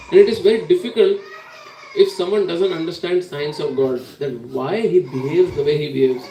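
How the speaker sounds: laughing through the words, a bit fast; amused, chuckling as you explain. Real, not acted. Speaker laughing at the story.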